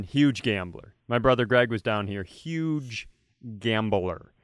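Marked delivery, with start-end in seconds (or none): none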